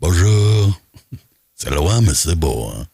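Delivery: mock-statesman impression, big drawn-out greeting; playful French politician caricature; Jacques Chirac. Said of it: "un Jacques Chirac".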